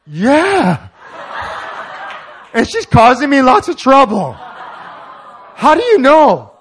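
loud, high, laughing astonishment; stunned laughing. Retold or imitated. Taxi driver stunned that the stranger saw his troubles, loud and laughing. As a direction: blurt it out, laughing in disbelief.

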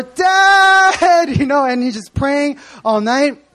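high loud wailing cry to Dad, laughing; crying out to Dad in prayer. Retold or imitated. Jesus crying out to his Father in prayer, loud and wailing. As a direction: wail it up at the Father. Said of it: "if it was Korean style".

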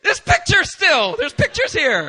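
high, loud shout, pointing out the pictures; drawn-out exasperated cry; mock exasperated shout. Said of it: "it's in Korean".

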